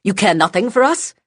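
angry, high, loud snap; snapping. Acted. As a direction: snap at her furiously. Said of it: "Silence snapped".

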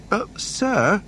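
very high, interjecting droid voice; C-3PO. A narrator's reading. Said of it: "C three P O interjected".

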